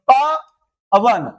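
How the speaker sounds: प, then वन, with a gap between; split into syllables. Shown as a demonstration. Teacher dividing पवन for students, प and वन held apart. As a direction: break the word after प, pause, then वन. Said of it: "तो प एक syllable".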